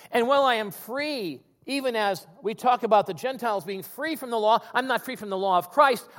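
preaching as Paul. Retold or imitated. Preacher voicing Paul continuing.

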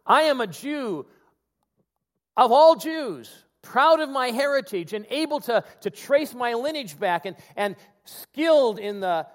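proclaiming as Paul. Retold or imitated. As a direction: declare it proudly, slow and strong. Preacher voicing Paul's declaration.

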